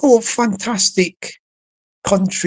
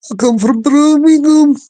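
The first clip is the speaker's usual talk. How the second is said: higher, louder put-on Brummie accent; Birmingham accent; 7 semitones higher, 6 dB louder than usual. Shown as a demonstration. Man showing off one of his few accents, in Birmingham accent. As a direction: put on a loud Brummie twang. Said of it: "one of the very few a-, accents I can do".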